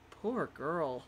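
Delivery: hushed cringe of sympathy; soft pity. Real, not acted. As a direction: murmur it, wincing. Cringing at the song.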